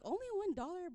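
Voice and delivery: high, mock-astonished question; a child's mock surprise at only one dollar. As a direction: pretend disbelief at the small amount. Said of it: "I'm like".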